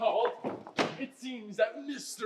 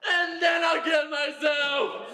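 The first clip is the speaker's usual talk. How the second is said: hammed-up despair; 4 semitones higher, 5 dB louder, much slower than usual. [name] melodramatically threatens suicide.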